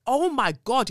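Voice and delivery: ordinary delivery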